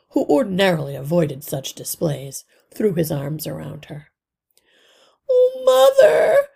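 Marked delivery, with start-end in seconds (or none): 5.3-6.5 high, loud, slow tearful cry; near tears. Acted. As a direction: cry out to her mother, on the edge of tears. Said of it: "cried Cynthia, near tears".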